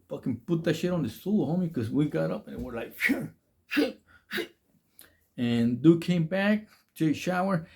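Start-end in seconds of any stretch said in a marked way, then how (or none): 3.0-4.5 high, strained panicked cursing; mock panic, pitched way up; panicked curse. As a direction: blurt it out in alarm, much higher. Panicking as bully returns.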